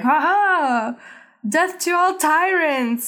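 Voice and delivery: high, drawn-out theatrical battle cry; mock assassin's cry. Assassin pops out at the emperor, hammed-up triumphant cry. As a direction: declaim it high and slow, hammed-up. Assassin leaps out from behind curtain.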